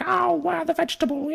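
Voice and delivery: put-on high, gushing city boy gawking at vegetables. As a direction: gush like a naive city boy. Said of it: "you're a city boy coming in like".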